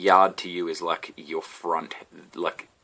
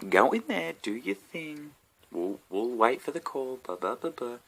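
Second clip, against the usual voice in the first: higher, softer, trailing off dismissively; mock crew again; 6 semitones higher, 4 dB quieter than usual. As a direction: wave him off with a sing-song brush-off. Crew sending him in again.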